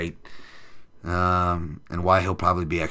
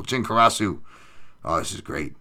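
ordinary talk

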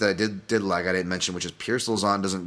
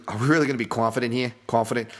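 quick put-on Boston accent; 3 semitones higher, faster than usual. Podcaster doubting the favorite, slipping into a Boston accent. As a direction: slip into a mock Boston accent. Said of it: "Boston accent there".

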